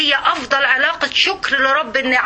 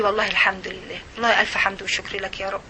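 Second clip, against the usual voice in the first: much softer, lower murmured thanks; inner grateful voice; 6 semitones lower, 6 dB quieter than usual. Retold or imitated. The inner self thanking God, soft and low. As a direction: hush it like private thanks to God. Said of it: "طول الوقت بتقولي".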